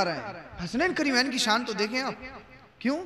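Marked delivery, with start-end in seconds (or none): none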